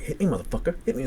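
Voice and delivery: high-pitched imitation of a goading dare; retold taunt. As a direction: mimic her egging you on, pitch up. Retold taunting, standing up to him.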